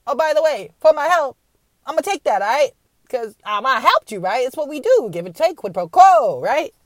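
mock generous benefactor; smug America. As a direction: sell the help like a con man. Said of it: "and then they're like".

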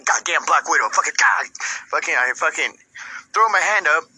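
ordinary talk

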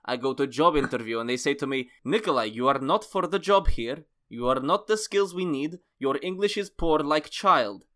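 put-on deliberate Russian-accented rejection. Host reading Nikolai's job interview, Russian accent. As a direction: pronounce judgement in a Russian accent. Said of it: "a bit of Russian in it".

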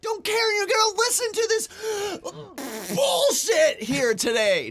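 high, strained mock-rant shout; hammed-up shouting. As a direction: yell it over the top, gasping midway.

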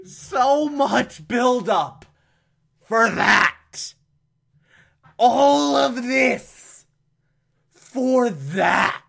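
high, slow, incredulous wail; so much build-up, drawn out high; exasperated disbelief. Real, not acted. Ranting about anticlimax.

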